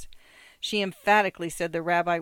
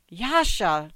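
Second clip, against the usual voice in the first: louder, understated cry of protest; shriek; 6 dB louder than usual. A narrator's reading. Wife protesting to her rabbi husband, understated shriek. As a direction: cry the name in mild alarm. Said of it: "shrieked his wife".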